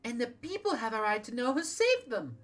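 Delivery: Ida. Acted. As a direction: strain up toward a high young voice. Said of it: "I cannot do Ida's voice".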